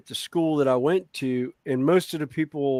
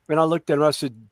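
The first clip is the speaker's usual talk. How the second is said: normal talking voice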